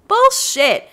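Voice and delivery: high, loud outburst of disbelief; indignant shout. Real, not acted. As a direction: yell it like you caught them lying. Calls out lying game villagers.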